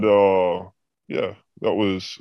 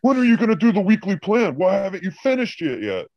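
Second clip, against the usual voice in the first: caricatured boss, pitched way up; imitating boss; 11 semitones higher than usual. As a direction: screech it like the petty director. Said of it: "boss quoted angrily".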